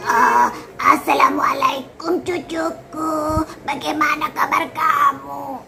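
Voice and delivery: put-on slow, strained elderly voice; creaky grandma greeting her grandchild; old grandparent voice. Shown as a demonstration. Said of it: "Suara orang-orang yang sudah sepuh".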